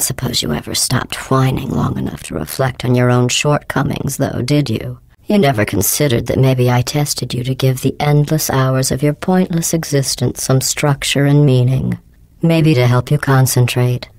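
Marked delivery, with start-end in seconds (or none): none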